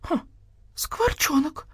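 high, softish note of astonishment; amazed. A narrator's reading. Hen recognises the starling, amazed. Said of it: "изумлённо сказала Кура четыре".

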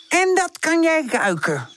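slow, high, teasing cat voice; sneering cat doubting the dog's nose; mocking. A narrator's reading. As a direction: drag it out to mock him. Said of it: "mouwt Buurpoes spottend".

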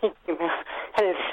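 ordinary talk, nothing marked

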